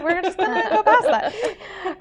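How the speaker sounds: high, slow, giggly admission; real laughing. Real, not acted. Reader admits skipping a hard word, breaking up laughing. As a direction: crack up while getting the words out.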